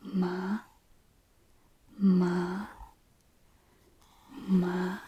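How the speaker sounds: "ma" gliding upward at the end; rising tone. Shown as a demonstration. Teacher showing Mandarin second tone, rising "ma". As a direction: lift each "ma" like a question. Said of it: "is the rising tone".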